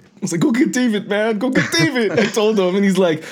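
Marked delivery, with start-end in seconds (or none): 0.4-2.1 high-pitched insistent imitation; retold urging. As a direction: send him after your friend, pitch way up. Retold urging usher toward friend.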